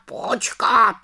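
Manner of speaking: raven interrupting. Acted. Raven Abraxas interrupting the little witch, high falling. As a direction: repeat it firmer, settling down. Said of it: "skočil jí do řeči Havran".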